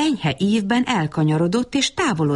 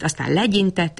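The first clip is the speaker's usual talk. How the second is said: usual speaking voice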